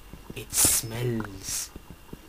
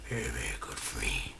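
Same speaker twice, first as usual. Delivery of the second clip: lower, breathy whisper to the zombie; whispered flattery; 7 dB quieter than usual. Pretend. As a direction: whisper it like a secret.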